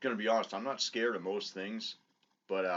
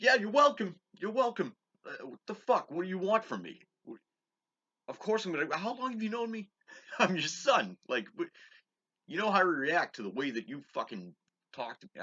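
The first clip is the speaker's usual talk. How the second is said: sarcastic yelling; 11 semitones higher, 7 dB louder than usual. Retold or imitated. Retold yelling at mother.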